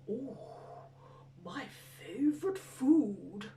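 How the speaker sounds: lingering monster relish; hungry monster. Acted. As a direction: savour it slowly, like a hungry beast. Gruffalo eyes the mouse as food.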